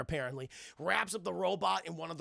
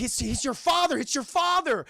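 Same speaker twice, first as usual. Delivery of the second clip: higher, louder urgent pleading; urgent plea; 10 semitones higher, 6 dB louder than usual. Retold or imitated. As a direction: plead fast to stop him. Terry pleading, Willie attacks dad.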